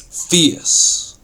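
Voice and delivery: FIERCE said with harsh, breath-driven force; a demonstrated fierce delivery; fierce word-painting. Shown as a demonstration. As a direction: make the word sound fierce. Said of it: "bring out the meaning of the word by its sound".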